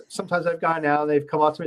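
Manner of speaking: normal talking voice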